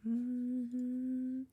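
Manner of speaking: normal talking voice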